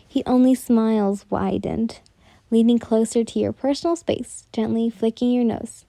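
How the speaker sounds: usual speaking voice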